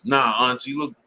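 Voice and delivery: mock-earnest plea to auntie; lightly voiced insistent nephew; serious nephew. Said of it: "Dead serious".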